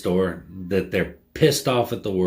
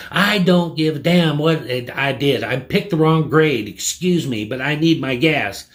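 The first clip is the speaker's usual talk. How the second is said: imitated angry outburst, pitched up; higher-pitched mock indignation; irate customer; 5 semitones higher, 3 dB louder than usual. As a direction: cut in like a furious customer. Acting out customer cutting him off.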